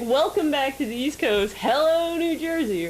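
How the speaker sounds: high, mock-cheery sarcastic greeting; sarcastic welcome. Sarcastically greeting New Jersey after the cab scam, mock-cheery.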